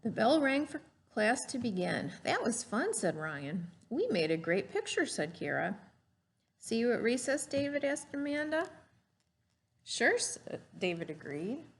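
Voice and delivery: lively storybook read-aloud; gentle classroom story voice; reading to children. Acted. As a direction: read it like a picture book to a class. Teacher reads picture book aloud.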